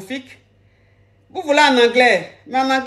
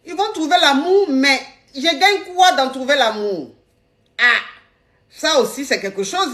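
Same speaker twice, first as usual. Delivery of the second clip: usual speaking voice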